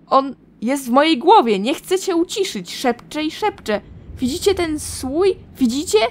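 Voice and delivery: loud, tormented pleading that breaks into a yell; a woman driven mad by a voice in her head; frantic madwoman. Acted. Karsa begs the voice to stop, frantic and loud.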